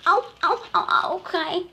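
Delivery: high, loud mock ows; little elephant voice. Little elephant being shooed, high mock ows. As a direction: yelp like a pushed little calf.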